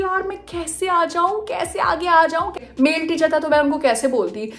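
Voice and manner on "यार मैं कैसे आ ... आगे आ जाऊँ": high, slow helpless whine; pleading panic. Retold or imitated. Stuck in her seat, pleading to herself in high panic. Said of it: "मैंने बोला कि".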